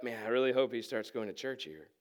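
low, soft aside to a neighbour; hushed aside. Acted. Congregation eyeing a rich newcomer in church, hushed. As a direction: say it privately, under your breath.

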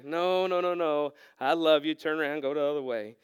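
calm him like a loving father; gentle God. Pretend. God redirecting the lost preacher, gentle and patient.

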